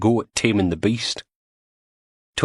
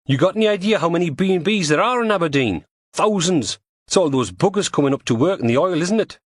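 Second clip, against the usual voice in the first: higher, exasperated Scottish man; 10 semitones higher than usual. Acted. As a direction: scoff like a fed-up Scot. Tony scoffs at the idea.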